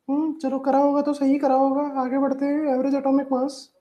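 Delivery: high-pitched put-on student voice; mimicked hesitant student. Student talking themselves out of asking a doubt, mock high voice.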